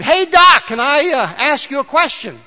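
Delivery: eager mechanic hailing the doctor; mechanic calling out. Retold or imitated. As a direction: call across the shop to the doctor. Mechanic voiced in a joke.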